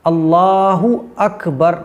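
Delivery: अल्लाहु अकबर very slow, with a full u on अल्लाहु; slow teaching demo of अल्लाहु अकबर; correct pronunciation. Shown as a demonstration. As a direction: say अल्लाहु अकबर slowly and clearly, u joined to अकबर. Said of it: "तो ये सही pronunciation है".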